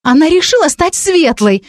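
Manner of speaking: high, incredulous exclamation; scandalised exclamation. A narrator's reading. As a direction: announce it like the greatest absurdity. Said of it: "воскликнула Вика таким голосом". (воскликнуть как о величайшей глупости)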